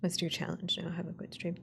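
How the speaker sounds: ordinary talk, nothing marked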